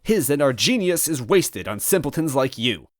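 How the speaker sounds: high, fast, offended henchman; huffy villain toad. Acted. Villain defending his odd name, high and huffy. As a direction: snap back defensively, puffed up.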